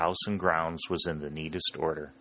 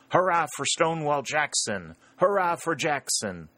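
shouted cheer; 8 semitones higher than usual. A narrator's reading. Northern troops cheering their enemy Jackson, shouted hurrah. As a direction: cheer it like a whole company. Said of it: "the words shouted in chorus".

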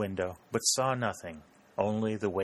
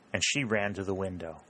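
plain reading voice

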